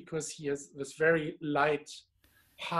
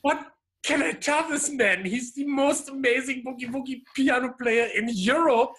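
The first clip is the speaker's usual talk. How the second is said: starstruck; 8 semitones higher, 5 dB louder than usual. Retold or imitated. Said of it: "And I was like".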